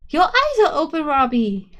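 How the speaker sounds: high, loud delighted squeal; little sister cheering her brother awake, high and loud; excited child. A narrator's reading. Little sister sees him awake.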